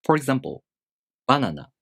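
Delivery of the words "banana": banana with a high first beat, then dropping low; high-low pitch accent. Shown as a demonstration. Teacher giving an example of the first rule, high-then-low accent. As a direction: start banana high, drop straight after. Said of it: "The first molar starts high in pitch".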